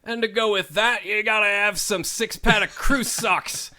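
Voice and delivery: high, loud hammed-up Scottish accent; a bad Scottish upsell; terrible Scottish accent. Call center worker upselling socks in a terrible Scottish accent. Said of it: "some terrible Scottish accent".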